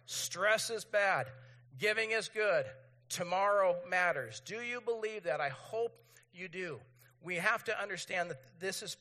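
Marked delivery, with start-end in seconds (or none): 0.1-4.4 louder, slow, punchy slogans; emphatic, measured recital; leading group recitation. Real, not acted. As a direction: get the whole room chanting along. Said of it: "Say it again with me".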